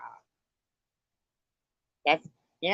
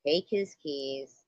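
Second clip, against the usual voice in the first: ordinary talk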